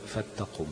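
فاتقم with the lam swallowed into the ta, said softly; wrong merged lam. Shown as a demonstration. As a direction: say فاتقم as if the lam were assimilated. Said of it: "لأنها قد تدغم". (فلتقم مدغمة خطأً: فاتقم)

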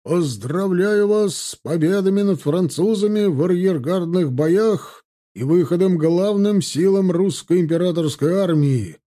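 high, loud general's proclamation; rousing shouted congratulation; loud exclamation. A narrator's reading. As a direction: proclaim it on a full chest of air. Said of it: "Набрав в грудь воздуха, воскликнул генерал".

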